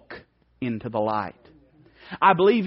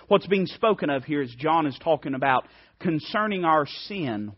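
ordinary talk, nothing marked

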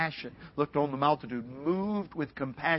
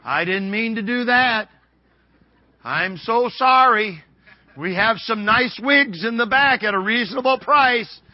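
high, loud comic apology; lightly acted hairdresser, raised and over-sweet for laughs; mock apologetic hairdresser; 8 semitones higher, 11 dB louder, slower than usual. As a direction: play an apologizing hairdresser, pitched up.

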